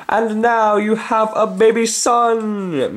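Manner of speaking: put-on high, slow, childish announcement; John's voice. Reader voicing John playing at parents, high and slow. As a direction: announce it like a little boy playing house. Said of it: "John is speaking here".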